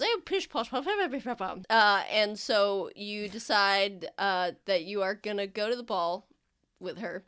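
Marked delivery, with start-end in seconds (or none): 0.0-1.6 high pish posh, fading down; dismissive noble lady. Acted. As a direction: wave it off like a haughty lady. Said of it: "And then she goes".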